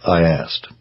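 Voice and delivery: usual speaking voice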